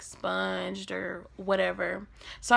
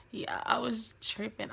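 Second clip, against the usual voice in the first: ordinary talk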